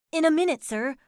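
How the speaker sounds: high, loud coachman's exclamation; exclaiming. A narrator's reading. Driver caught drinking answers his fare, exclaiming. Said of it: "he exclaimed".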